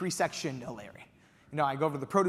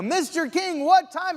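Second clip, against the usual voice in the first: high, loud frantic plea; desperate begging; 13 semitones higher, 8 dB louder, much slower than usual. Retold or imitated. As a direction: beg through the windshield.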